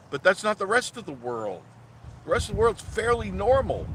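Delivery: high-pitched, incredulous ranting; worked-up, can't-believe-it protest; exasperated rant. Real, not acted. Exasperated rant about gender.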